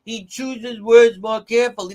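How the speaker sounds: loud, high-pitched mocking accent impression; mock Charlene Lima accent. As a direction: mimic her thick accent, pushing it high and loud. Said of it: "in that strong Eileen Violent accent".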